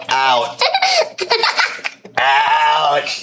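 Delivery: put-on playfully yelling at the kid to get out; mock order. Ordering child out to yard.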